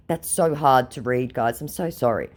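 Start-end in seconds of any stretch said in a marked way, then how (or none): none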